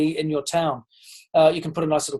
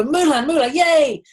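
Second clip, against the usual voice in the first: put-on excited little boy; 8 semitones higher than usual. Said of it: "And he'd go".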